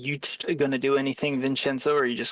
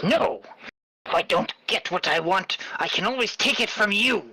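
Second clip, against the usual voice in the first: higher, forceful threat to the angel; ringmaster's refusal; 8 semitones higher than usual. Acted. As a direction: push back hard and threaten to take it.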